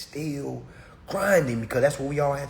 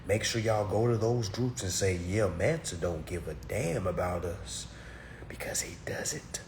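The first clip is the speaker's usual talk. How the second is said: soft, hushed defiant aside; conspiratorial whisper; 6 semitones lower, 9 dB quieter than usual. Real, not acted. Speaker telling listeners to gossip, in a whisper. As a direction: whisper it like a secret dare.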